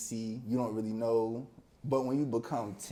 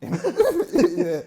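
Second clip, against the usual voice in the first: loud laugh; 13 dB louder than usual. Real, not acted. Guest recalling friends' teasing, laughs out loud. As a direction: burst of high loud laughter.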